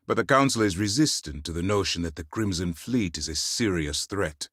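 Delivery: ordinary talk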